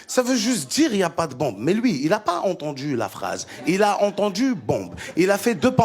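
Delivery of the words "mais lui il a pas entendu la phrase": normal talking voice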